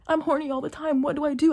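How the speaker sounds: high, whiny mock complaint; mocking male arguer. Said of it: "The first thing he says is".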